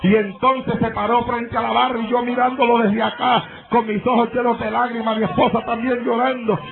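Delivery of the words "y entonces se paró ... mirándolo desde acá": ordinary delivery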